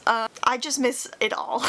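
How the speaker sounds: usual speaking voice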